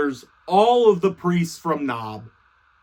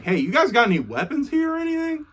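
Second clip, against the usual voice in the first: higher, fast, breezy ask; casual ask; 8 semitones higher, faster than usual. Retold or imitated. David sweetly asking the priest for weapons, higher and fast. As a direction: toss it off quickly and sweetly, as if it's nothing.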